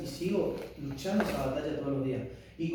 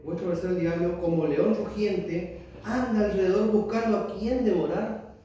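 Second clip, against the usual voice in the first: solemn scripture reading; 2 semitones higher, 4 dB louder than usual. Acted. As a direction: read each word of the verse like a proclamation. Reads scripture aloud.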